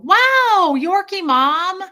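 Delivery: high, loud delighted squeal of a name; drawn-out happy shout-out; excited greeting. Real, not acted. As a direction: greet her like a long-lost friend.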